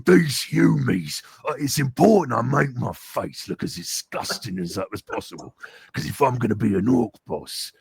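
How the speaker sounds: menacing orc brute voice; angry orc. Shown as a demonstration. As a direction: growl it like an orc boss. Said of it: "like, an angry orc".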